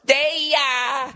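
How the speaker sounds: very high, slow sing-song greeting; mock perky flight attendant drawing out each syllable; overly bubbly stewardess. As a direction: stretch every word sweetly. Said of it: "adding syllables".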